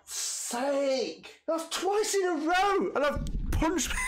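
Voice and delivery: high, shouting disbelief; high-pitched outraged laugh-shout; exasperated squeal. Real, not acted. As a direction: yelp it in frustrated disbelief. Frustrated at game mishap.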